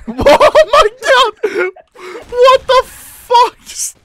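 slow, high, loud disbelief; shocked shout. Real, not acted. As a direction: drag out the shocked cry. Shocked at teammate.

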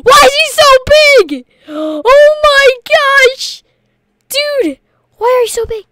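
high, loud gasping disbelief; high shocked cries at the giant. Real, not acted. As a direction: gasp and cry out in shock. Gasping at huge Foxy.

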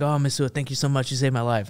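gushing French reporter; French accent. Acted. Rescued French reporter thanking the marine, in a French accent. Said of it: "I can do a French accent".

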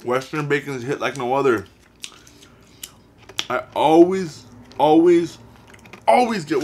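chewing while talking; talking around food; mouth full. Real, not acted. Seen 0: Bit burger at -9; mouth still working food while speaking.

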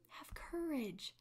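slow, soft whisper; a slow breathy reassurance whispered close. Acted. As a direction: hush it tenderly, slowly. Jesus repeats softly after calling.

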